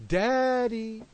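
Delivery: put-on high little girl's voice calling daddy; baby calling daddy. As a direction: call out like a toddler at night. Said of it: "what would she say?".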